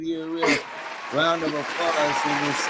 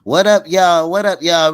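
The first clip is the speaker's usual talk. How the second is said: shout-out; 9 dB louder than usual. Real, not acted. Shout-out to viewers.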